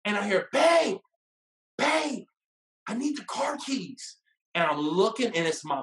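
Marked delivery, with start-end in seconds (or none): 0.5-4.2 high-pitched mom calling out; imitated mother. Mom pops in on son's movie date, imitated high call. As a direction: mimic a mother interrupting, higher voice. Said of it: "we hear this voice, this head pops in between us".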